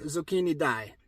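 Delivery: usual speaking voice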